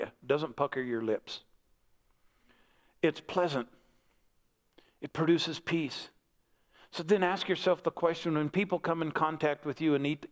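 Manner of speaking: ordinary talk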